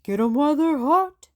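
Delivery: dad calling from the grill; hollering dad. Acted. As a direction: holler it across the yard. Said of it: "hollered Dad".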